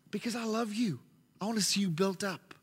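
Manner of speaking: soft, gentle father's voice; tender reassurance. Retold or imitated. Father gently telling his son why he gets sweets, tender and soft. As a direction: reassure him softly, with love.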